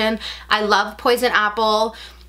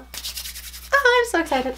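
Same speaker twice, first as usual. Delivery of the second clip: excited; 7 semitones higher, 4 dB quieter, much slower than usual. Real, not acted. Still unboxing, drawn out.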